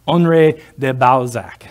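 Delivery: Honoré de Balzac said slow and full in a French manner; savoring the French name. Shown as a demonstration. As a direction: relish every sound of Honoré de Balzac, slowly. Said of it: "That's just fun to say".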